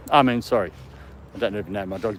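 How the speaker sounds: normal talking voice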